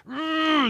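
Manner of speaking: high strained ooh while squeezing; straining ooh. Retold or imitated. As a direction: strain like you're squeezing hard. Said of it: "and then they move it around".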